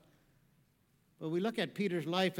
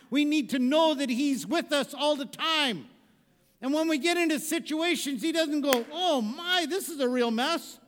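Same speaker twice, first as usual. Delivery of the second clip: loud, higher fervent preaching; 6 semitones higher, 8 dB louder than usual. Real, not acted. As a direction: press each line on the flock. Preaching at full force.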